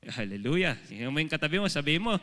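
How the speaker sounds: normal talking voice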